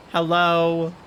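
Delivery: incredulous hello. Real, not acted. Reacting to chat spam.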